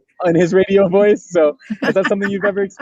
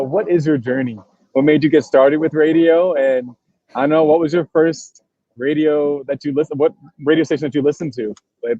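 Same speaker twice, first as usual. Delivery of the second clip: ordinary talk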